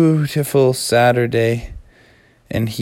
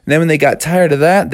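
ordinary delivery

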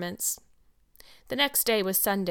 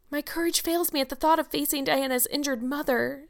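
high, plaintive dread; sighing; 6 semitones higher than usual. A narrator's reading. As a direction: say it with a forlorn sigh in a girlish voice. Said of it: "sighed Anne".

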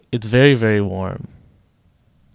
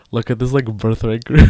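loud mocking sneer, ending on a rise; imitating Israelis gawking at a tour group; mock stare-down comment, punched loud at the end; mocking onlookers; 2 semitones higher, 5 dB louder than usual. Mocking Israelis staring at Americans.

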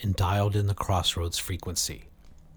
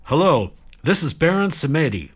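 confident announcement; 7 semitones higher, 7 dB louder than usual. Acted. Baron takes the mic.

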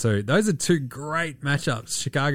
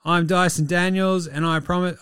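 slow, higher cheesy commercial voice; mock ad read; 5 semitones higher, slower than usual. Host parodying a cleaning services ad, slow cheesy pitch. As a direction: sell it like a cheap local TV ad.

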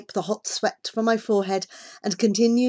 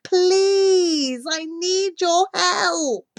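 slow, loud and high, thick with panic; slow, panicked plea; 7 semitones higher, 6 dB louder, slower than usual. A narrator's reading. Child explaining to a boy who misunderstood, slow panicked pleading. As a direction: spell it out slowly, climbing into a desperate plea. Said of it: "I speak more slowly this time, my voice thick with panic".

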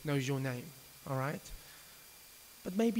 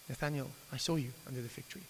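gentle reassurance; 3 semitones lower, 8 dB quieter, faster than usual. Acted. Jesus gently addressing Nathanael.

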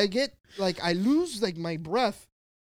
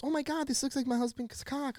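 high, softer imitated girl's gush; girl's exclamation; 8 semitones higher, 5 dB quieter than usual. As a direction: pitch it up lightly as the girl. Voices the girl again.

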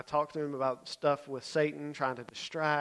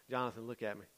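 soft, lower request for attention; quiet first try; 4 semitones lower, 9 dB quieter than usual. Retold or imitated. Leader to a boy looking over his head, quiet and low. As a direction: ask him calmly, low and soft.

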